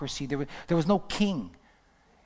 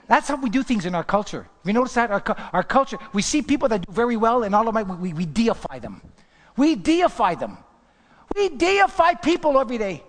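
loud, raised preaching voice; fervent preaching; 6 semitones higher, 6 dB louder than usual. Real, not acted. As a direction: press the point, building to a high cry. Preaches at full force.

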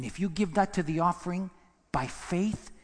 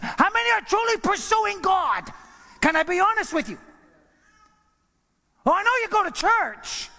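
higher and louder, building preacher cadence; preaching at full force; 5 semitones higher, 3 dB louder than usual. Acted. Preacher breaking down the verse for the church, at full force. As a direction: build each number to a shout.

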